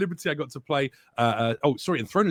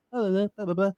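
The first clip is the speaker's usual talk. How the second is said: small, high, inert mock delivery; mock seated voice actor; 11 semitones higher, faster than usual. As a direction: imitate an actor barely performing. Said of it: "they sit on the tall chair and they kind of".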